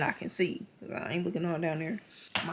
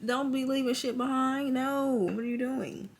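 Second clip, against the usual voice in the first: indignant; 8 semitones higher, 4 dB louder than usual. Real, not acted. Scolding the surgeons.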